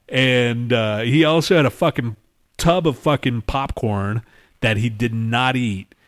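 normal talking voice

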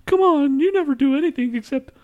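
high, loud whiny mimicry of his buddy; mocking whiny friend. Teasing his friend by mimicking his whine, high and loud. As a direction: parrot him back in a high nagging whine. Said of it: "mocks friend's whiny complaint".

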